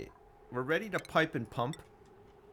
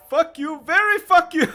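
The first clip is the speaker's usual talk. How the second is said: much louder, higher mock yell in an Indian accent; Indian accent shout; 15 dB louder than usual. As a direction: belt it out like the clip, Indian accent. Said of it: "Their, their accents are great".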